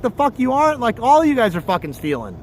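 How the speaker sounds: usual speaking voice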